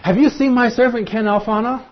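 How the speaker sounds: God showing off His redeemed people to the angels; proud God. Acted. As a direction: point him out to the whole court, thrilled. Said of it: "God is saying to the watching angels".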